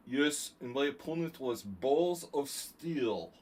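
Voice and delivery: put-on slow, thick Russian-Georgian New York accent; hammed-up Russian-New York accent, very slow; Roman Gingiashvili. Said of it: "he has this really thick, like, Russian, Georgian accent".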